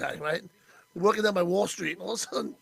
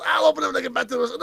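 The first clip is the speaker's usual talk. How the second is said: rushed retold Archie; 3 semitones higher, much faster than usual. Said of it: "He goes".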